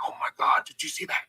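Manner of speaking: put-on hushed, breathless kid voices; whispered kids' amazement. Kids at a birthday party, amazed at a trick, whispering.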